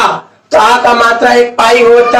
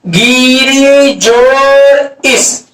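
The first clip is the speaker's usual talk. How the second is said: गिरी जोड़ इस drawn out word by word; very slow dictation; much slower than usual. Shown as a demonstration. Teacher dictating the example very slowly to students. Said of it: "आपको example कहेगा".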